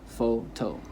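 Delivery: PHOTO split into FOH and TOH; syllable by syllable. Shown as a demonstration. Teacher showing the two syllables, photo broken in two. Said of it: "has two syllables".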